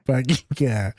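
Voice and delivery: mock Boston accent; imitating Boston speech. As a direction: echo it in a put-on Boston accent. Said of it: "What a lovely accent".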